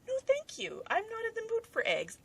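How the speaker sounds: very high, put-on little voice; high-pitched polite refusal. Answering anyone offering eggs, very high mock-polite refusal.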